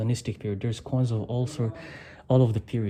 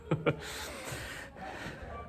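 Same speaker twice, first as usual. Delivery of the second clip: breathy laugh; 11 semitones higher, 10 dB quieter than usual. Real, not acted. Laughing at her earring wish.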